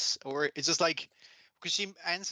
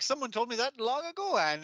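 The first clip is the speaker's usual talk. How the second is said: lightly voiced boy; 8 semitones higher than usual. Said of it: "and it's like".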